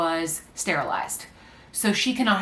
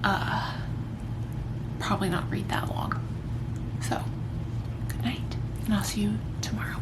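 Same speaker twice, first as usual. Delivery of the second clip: whispering; 2 semitones higher, 5 dB quieter than usual. Real, not acted. Whispering goodnight at bedtime.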